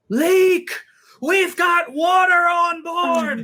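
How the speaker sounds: high, drawn-out panicked shout; screaming. Acted. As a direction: scream a warning across the deck. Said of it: "start screaming".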